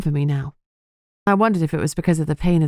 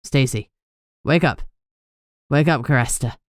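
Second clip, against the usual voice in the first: sharp and urgent; 3 semitones lower than usual. Acted. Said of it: "sharp and urgent".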